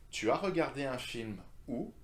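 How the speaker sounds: high on "regardé", then sharp lift on "où"; rising intonation. Shown as a demonstration. Teacher models question word at the end, rising intonation. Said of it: "With the rising intonation".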